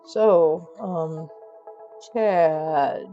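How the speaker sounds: low, halting, awkward opener; awkward stoic. Acted. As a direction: break the silence reluctantly. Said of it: "broke even Bridger's stoic silence".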